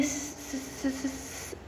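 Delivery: stammered first sound, fading to nothing; dying stutter. Retold or imitated. Dying boy struggles to name the danger, fading stutter. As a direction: imitate a last breath stuck on one sound.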